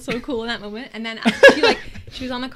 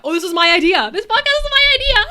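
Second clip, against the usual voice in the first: loud, high, triumphant realization; excited exclamation; 11 semitones higher, 14 dB louder than usual. Real, not acted. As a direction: shout it out with sudden triumph. Realizing the idea was hers.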